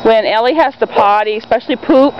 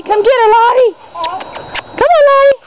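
urging the dog; 14 semitones higher than usual. Real, not acted. Owner cheering her dog to chase the other.

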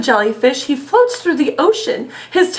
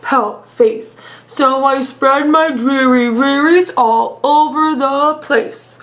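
slow, drawn-out mopey fish voice; glum pout fish voice; 2 semitones lower, slower than usual. Acted. Teacher reads the sulky fish refrain, slow and mopey. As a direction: drag each word out like a gloomy sulking fish. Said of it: "I love doing the silly voices".

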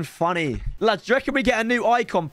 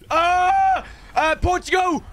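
excited yell; 13 semitones higher, slower than usual. Real, not acted. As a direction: scream at the walkout. Shouts at pack walkout.

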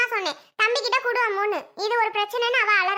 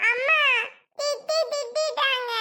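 put-on high, slow, halting child complaint; tearful child; 4 semitones higher, slower than usual. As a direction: tattle to mother in broken sobbing syllables. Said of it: "அழுதுட்டு உட்காந்துருக்க". (அழுதபடி புகார் சொல்லும் குழந்தை)

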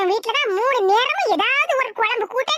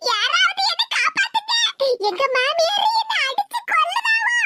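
cry for help; 7 semitones higher than usual. Acted. Daughter-in-law being beaten, crying out.

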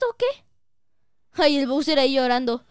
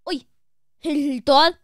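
normal talking voice

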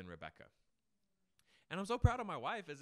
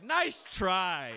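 slow taunt; 5 semitones higher, 15 dB louder than usual. Acted. Taunting Satan.

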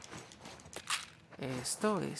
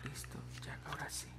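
whisper; 2 semitones higher, 19 dB quieter than usual. Real, not acted. Sneaking in stealth game.

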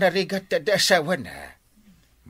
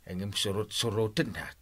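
ordinary talk

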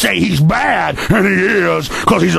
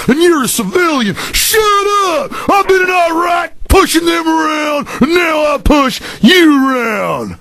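higher, shouted sneering cop voice; imitating bullying cop; 3 semitones higher than usual. Radio host imitating a bullying cop for callers, shouted sneer. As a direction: bark it like a power-drunk cop lording over citizens. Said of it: "that's an imitation of 'em".

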